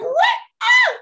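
a high-pitched cry of joyful surprise; excited shriek. Retold or imitated. Speaker reliving spotting a Bajan flag in Iceland, excited shriek. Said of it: "It's like".

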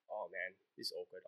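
very soft, low thought to yourself; hushed, low-pitched awkward aside; awkward inner aside. Retold or imitated. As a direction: say it under your breath, low and uneasy. Acted awkward thought, crying girl.

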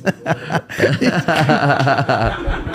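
cracking up while grabbing the sandwich; spotting the Jersey Mike's and laughing; real laughter. Real, not acted.

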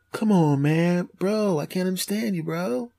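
soft, high SoCal bro voice; Southern California accent. Acted. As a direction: whine it lightly like a laid-back Southern California bro. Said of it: "Southern California guy".

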